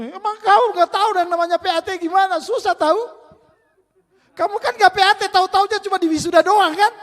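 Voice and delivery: put-on high, loud scolding child's voice; annoyed child. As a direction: snap back like an offended little sister.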